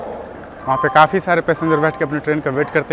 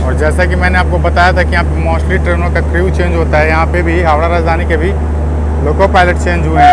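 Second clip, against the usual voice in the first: loud over train noise; 3 semitones higher, 10 dB louder than usual. Real, not acted. Calling over departing train noise.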